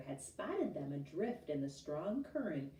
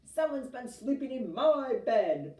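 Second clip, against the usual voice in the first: loud bellow, stress on my; bellowing Papa Bear; 6 semitones higher, 10 dB louder than usual. A narrator's reading. Papa Bear finding his bed slept in, loud bellow. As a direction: bellow it, leaning on my. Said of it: "Papa Bear bellowed".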